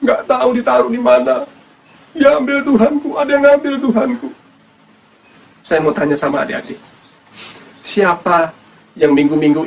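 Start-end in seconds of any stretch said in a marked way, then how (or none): 0.0-4.3 high, plaintive lament; acted anguished pleading; distressed woman. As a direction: play Mary in tearful distress, pitched well up. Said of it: "Lalu si wanita itu menjawab".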